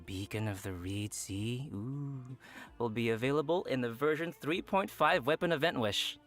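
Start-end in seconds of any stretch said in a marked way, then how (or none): none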